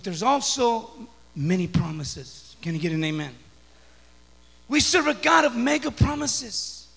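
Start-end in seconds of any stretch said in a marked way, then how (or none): 4.7-6.9 raised, emphatic preacher's voice; rally the church in punchy, loud phrases; forceful preaching. Real, not acted.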